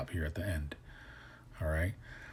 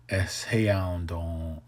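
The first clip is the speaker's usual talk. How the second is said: "Es heandon" stretched slowly, every sound clear; slow demo; much slower than usual. Shown as a demonstration. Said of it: "the one to ask somebody".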